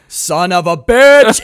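loud, slow, drawn-out cry; weird Nicolas Cage-style exclamation. Retold or imitated. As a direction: punch it high and hard, stretching every word. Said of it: "weirdest way anyone's ever said that phrase".

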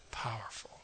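hushed single word; whispered. Real, not acted. Preacher after the church shooting story, whispered awe. Solemn reflection.

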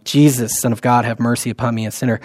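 soft, low, quick rote murmur; hushed, quickened prayer; quiet repeated prayer. Retold or imitated. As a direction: murmur it like beads on a prayer rope. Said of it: "The Orthodox will say it with a prayer rope".

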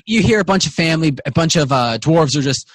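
ordinary talk

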